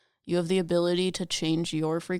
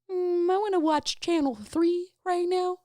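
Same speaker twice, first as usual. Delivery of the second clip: high, slow, dreamy put-on voice; mock dreamy voice; 13 semitones higher, 4 dB louder, slower than usual. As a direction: play the lazy viewer picking a channel. Mimicking a channel-surfer.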